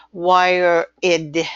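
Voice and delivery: "wire id" said as two words with an added syllable; mispronounced wire id. Retold or imitated. A foreign dictator misreading an abbreviation as "wire id". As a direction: imitate a learner sounding out "wire id". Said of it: "they think they have to say it".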